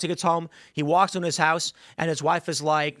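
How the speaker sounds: ordinary delivery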